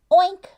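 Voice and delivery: loud, high piggy oink; big playful oink for the little ones. Pretend. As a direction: squeal it out like a happy pig. Voicing pig sound for kids.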